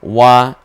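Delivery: ওয়া said loud and full, the vowel fully sounded. Shown as a demonstration. Teacher showing the full letter sound. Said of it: "ওয়া ছিল ওয়াই থাকবে".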